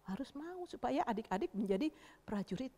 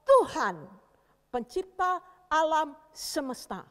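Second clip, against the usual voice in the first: louder, higher, measured declaration; David's bold challenge; 7 semitones higher, 6 dB louder than usual. Acted. Young David facing armed Goliath, bold proclamation. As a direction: declare fearless faith, phrase by phrase. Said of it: "Dia bilang gini".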